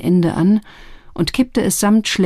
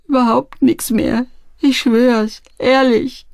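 high, whimpering voice; scared lad insisting on honesty; pleading oath; 7 semitones higher, slower than usual. A narrator's reading. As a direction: swear it pitifully, pitched up. Frightened lad swears he has nothing.

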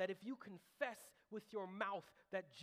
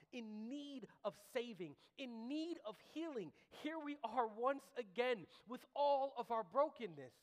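sermon building higher and louder; preaching climax; 7 semitones higher, 7 dB louder than usual. Real, not acted. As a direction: swell to a loud, impassioned plea.

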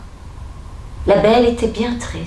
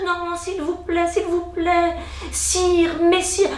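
high, softer frightened pleading; 8 semitones higher, 3 dB quieter, slower than usual. A narrator's reading. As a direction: beg for mercy, trembling. Father pleading with the beast.

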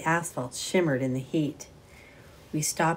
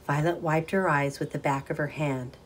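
plain reading voice

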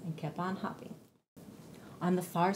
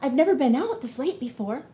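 excited bunny; 4 semitones higher, 6 dB louder than usual. Acted. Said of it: "he said".

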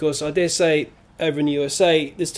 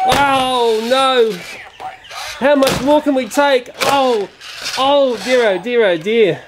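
mock despair; 5 semitones higher, slower than usual. Watching the toys get wrecked.

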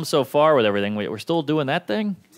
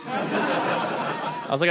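unvoiced laugh; 3 semitones higher, 6 dB quieter, much slower than usual. Real, not acted. Laughing hush.

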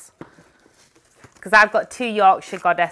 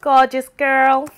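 put-on Yorkshire "gorgeous girl", louder, slow and higher; drawn-out Yorkshire; 6 semitones higher, 6 dB louder, slower than usual. Shown as a demonstration. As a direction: savour the broad Yorkshire vowels. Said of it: "hear the sound of the Yorkshire dialect".

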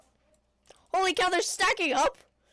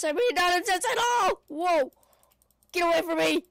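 loud, higher outburst; raised-voice game panic; alarmed yelling; 3 semitones higher, 6 dB louder than usual. Real, not acted. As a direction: yell to fend off attackers. Zombies attack in the game.

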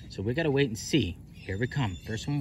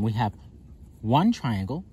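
ordinary talk, nothing marked

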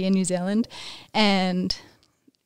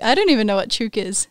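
ordinary talk

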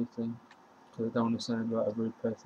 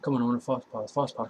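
ordinary delivery

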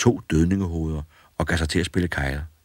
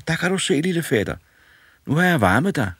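put-on boy's voice, much higher, proud; proud of warming the dead man; pleased boy; 12 semitones higher, 4 dB louder, faster than usual. A narrator's reading. Boy proudly tells the corpse he warmed him, much higher.

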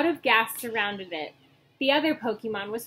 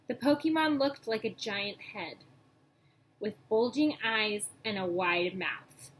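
ordinary delivery, nothing marked

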